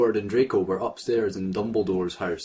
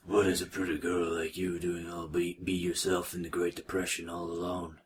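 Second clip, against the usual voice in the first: lightly voiced smooth flirt; 4 semitones lower, 8 dB quieter than usual. Reading a flirty line in voice.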